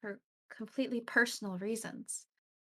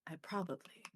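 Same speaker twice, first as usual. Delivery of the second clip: whispering; 9 dB quieter than usual. Acted. Reading a confession, whispered.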